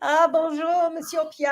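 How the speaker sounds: Ah bonjour, lifted high in a French lilt; playful French greeting. Pretend. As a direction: play the charming French greeter. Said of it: "greeting Pierre in French".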